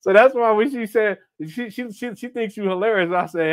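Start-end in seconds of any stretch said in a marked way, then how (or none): none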